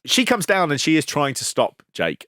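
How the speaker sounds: ordinary talk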